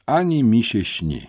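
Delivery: loud, high, bold refusal; heroic defiance. A narrator's reading. Boy refuses to pay for protection, heroically defiant. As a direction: refuse grandly, with bravado. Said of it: "powiedziałem bohatersko".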